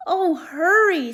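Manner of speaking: high, loud, slow begging; urgent plea. Acted. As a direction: beg him to hurry.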